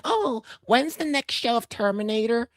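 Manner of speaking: light, high-pitched mimicry of a tourist; park visitor. Voicing a theme park visitor.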